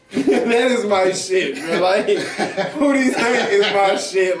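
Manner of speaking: slow, laugh-filled praise. Real, not acted. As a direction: laugh through the words, drawn out. Laughing while praising Pootie Tang.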